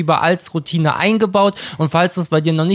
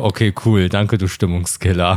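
deadpan sarcastic; 8 semitones lower than usual. Retold or imitated. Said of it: "Und ich so".

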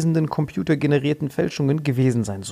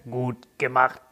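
boss voice; 3 semitones lower, 4 dB quieter, slower than usual. Retold or imitated. Boss voiced in acted scene.

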